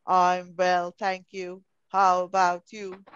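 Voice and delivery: lightly imitating a serious robot, low in pitch; robotic, very serious. Teacher imitating how a pupil answered her, like a serious robot. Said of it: "spoke to me like he's a robot, very serious".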